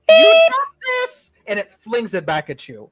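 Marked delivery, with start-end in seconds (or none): 0.1-1.3 very high, loud, slow squeaky cat creature; shrill taunting creature squeal. Acted. Invisible cat creature taunting the player, high squeal. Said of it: "It picks up the knife and goes".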